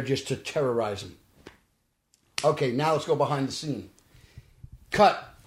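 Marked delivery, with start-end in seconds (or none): 4.9-5.3 a sharp, raised set call; mock director's call. Calling cut on set.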